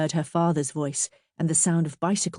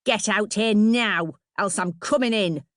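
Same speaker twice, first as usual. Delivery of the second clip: irate woman hollering; bellowed; 4 semitones higher than usual. A narrator's reading. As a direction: bellow a demand at a closed door. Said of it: "she bellowed".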